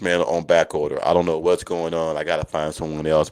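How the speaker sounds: ordinary delivery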